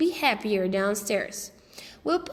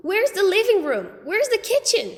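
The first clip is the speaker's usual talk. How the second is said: high, loud anxious questions; frantic, looking for rooms; alarmed questions; 9 semitones higher, 6 dB louder than usual. A narrator's reading. Peter alarmed at tiny house.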